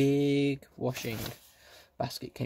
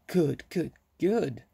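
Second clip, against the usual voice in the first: high, eager good good good; bubbling over with joy; joyful; 4 semitones higher, slower than usual. A narrator's reading. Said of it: "clapping hands for joy".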